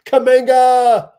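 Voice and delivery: big, loud sportscaster holler on the finish; excited shout. Real, not acted. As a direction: yell the name, high and drawn out. Cheering a player's dunk live.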